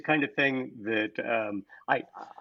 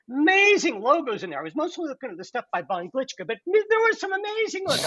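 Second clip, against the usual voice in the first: imitating the caller; 12 semitones higher than usual. Imitating the doctor on phone.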